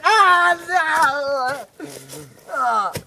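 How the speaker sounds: loud, high mock scream; hammed-up blinded wail; agonised scream. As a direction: cry out as if the light burns. Blinded by the dentist's light.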